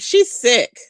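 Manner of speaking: ordinary delivery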